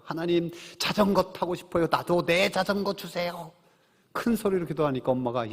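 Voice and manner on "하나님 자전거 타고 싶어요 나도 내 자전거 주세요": 어린아이가 조르듯 기도하는 흉내; 아이의 큰 기도. Retold or imitated. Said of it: "한 아이가 큰 소리로 기도하는". (아이가 큰 소리로 떼쓰듯 기도)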